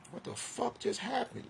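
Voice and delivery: high, soft, incredulous squeak; baffled disbelief. Real, not acted. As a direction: ask it in stunned disbelief. Exasperated disbelief, falsetto.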